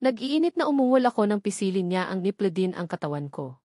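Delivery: usual speaking voice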